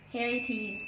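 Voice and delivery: ordinary talk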